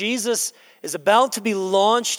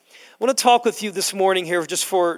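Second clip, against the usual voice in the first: ordinary talk, nothing marked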